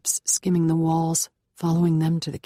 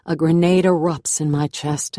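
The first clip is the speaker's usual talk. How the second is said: plain reading voice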